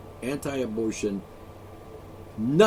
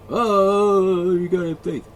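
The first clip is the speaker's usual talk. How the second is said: mock believer; 4 semitones higher, 6 dB louder, slower than usual. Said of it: "no excuses, by the way".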